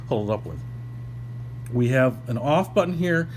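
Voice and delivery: ordinary delivery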